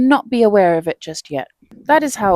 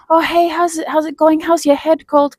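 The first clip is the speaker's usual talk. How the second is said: bright, higher friendly greeting; cheery colleague; 7 semitones higher than usual. Retold or imitated. Coworker greeting her about her cold, upbeat and higher. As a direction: play the chirpy coworker checking in.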